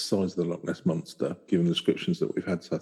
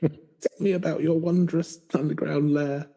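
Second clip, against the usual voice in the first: awed adventurer; 9 semitones higher, slower than usual. Pretend. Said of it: "pretending to meet leprechaun".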